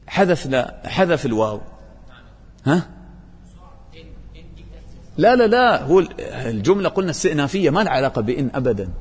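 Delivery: ordinary talk, nothing marked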